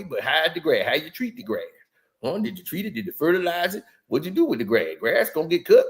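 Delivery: low, marble-mouthed mimicry; Shannon Sharpe. Mimicking a sports host lecturing a rival with grandfather's sayings, mush-mouthed impression. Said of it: "put some marbles in your mouth".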